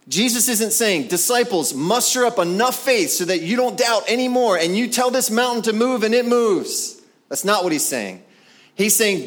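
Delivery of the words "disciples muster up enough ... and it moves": high, driving parody of a command; mock rallying cry, pitched high; mock exhortation. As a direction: urge them on in hammed-up earnest. Mimics what Jesus isn't saying.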